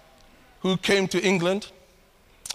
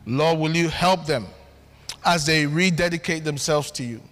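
closing prayer; 5 semitones lower than usual. Acted.

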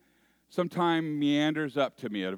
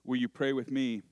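usual speaking voice

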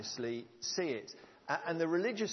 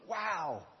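ordinary delivery

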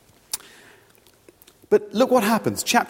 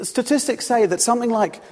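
usual speaking voice

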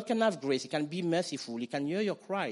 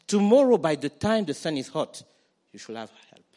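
loud proclamation; 8 dB louder than usual. Shown as a demonstration. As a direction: announce the promise with a burst of power. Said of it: "This you shall say to the men of Jabesh Gilead".

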